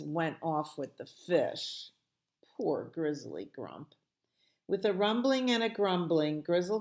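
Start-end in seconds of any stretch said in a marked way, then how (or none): none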